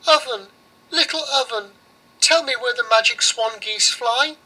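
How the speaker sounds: put-on high pleading little girl; girl begging the oven, voiced high; girl's voice. Girl asks the oven for help, high and earnest.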